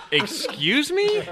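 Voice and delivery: very high, slow disbelieving squeak through a laugh; stunned disbelief. Retold or imitated. Caddy hears golfer's odd pep phrase, squeaks in laughing disbelief. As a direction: act floored by what you just heard. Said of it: "and I was like".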